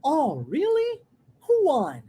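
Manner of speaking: "Oh, really?" swinging up high, "Who won?" falling; sing-song rise on "really", fall on "won"; marked intonation. Shown as a demonstration. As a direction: stretch "really" upward, then let "won" drop. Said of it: "listen and repeat".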